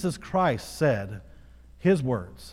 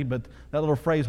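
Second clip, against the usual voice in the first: ordinary talk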